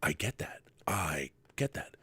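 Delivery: very soft, low imitation of his answer; William Shatner. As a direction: whisper it low, as if letting it sink in. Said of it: "And he's like".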